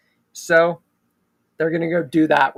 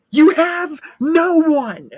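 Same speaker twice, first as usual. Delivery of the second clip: much higher and louder, slow and hard; forceful outburst; 11 semitones higher, 6 dB louder, slower than usual. Acted. Sydney confronting Jill at gunpoint, slow loud outburst. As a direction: throw the words at her.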